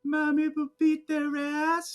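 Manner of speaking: high, slow little-girl imitation; drawn-out, high-pitched sheepish child; adorably sheepish. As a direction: mimic a cute, embarrassed kid. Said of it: "says it so adorably and sheepish".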